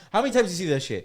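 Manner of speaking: lively shared memory, pitch up; excited. Real, not acted.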